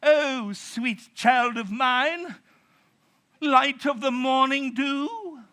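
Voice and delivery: high, loud, slow syrupy poetic voice; flowery mock speech. Shown as a demonstration. Preacher mocking a flowery translation, high and syrupy. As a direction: ham it up like an overwrought poet. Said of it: "There is no".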